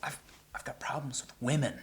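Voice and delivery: whispering, unvoiced; patient whispers his problem to the doctor; whisper confession. Real, not acted. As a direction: whisper it like a shameful secret. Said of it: "confessing to the doctor".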